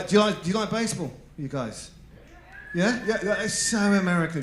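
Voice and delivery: ordinary delivery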